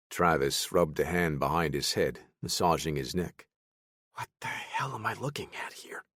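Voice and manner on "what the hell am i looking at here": breathy whispered disbelief; soft unvoiced whisper of confusion. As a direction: mutter it under your breath, baffled. Travis baffled under breath.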